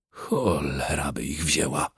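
ordinary delivery, nothing marked

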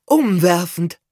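überschwänglich begeistert; Aunt Jolanthe. A narrator's reading. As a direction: burst out with delight. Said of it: "bestätigte Tante Jolanthe".

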